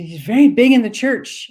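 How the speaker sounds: high, falling proud announcement; high proud old lady. Retold or imitated. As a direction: announce it like a proud elderly mother. Woman's voice retold.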